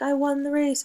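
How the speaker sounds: a winner cheering; excited winner. Shown as a demonstration. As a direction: shout it like you won the race. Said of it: "this is not like".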